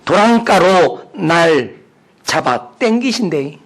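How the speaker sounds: slow, drawn-out Gyeongsang accent; Gyeongsang dialect. Shown as a demonstration. Preacher reading Psalm twenty-three for laughs, Gyeongsang dialect. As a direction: read scripture in broad Gyeongsang dialect, pausing between phrases. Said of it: "제가 한번 경상도 버전으로 읽어드리겠습니다".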